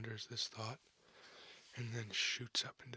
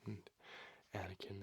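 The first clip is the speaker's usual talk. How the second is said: ordinary delivery, nothing marked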